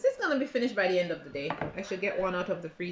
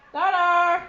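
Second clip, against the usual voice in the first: calling out; 8 semitones higher, 10 dB louder than usual. Real, not acted. Calling daughter to breakfast.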